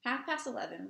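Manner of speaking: ordinary delivery, nothing marked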